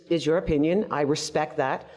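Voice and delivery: ordinary delivery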